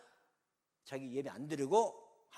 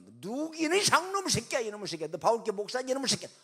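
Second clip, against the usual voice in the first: angry cursing; 7 semitones higher, 9 dB louder than usual. Retold or imitated. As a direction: hurl the abuse like an enraged caller. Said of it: "그 내용을 얘기하는 거예요".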